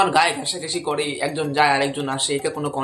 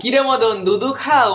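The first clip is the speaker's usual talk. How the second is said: mocking taunt; 5 semitones higher, 4 dB louder, slower than usual. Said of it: "মুখে উচ্চারণ হয় না".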